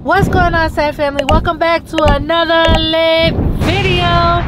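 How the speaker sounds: loud, hyped vlog greeting; big energetic channel intro. Real, not acted.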